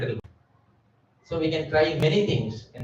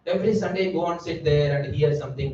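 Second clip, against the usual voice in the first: usual speaking voice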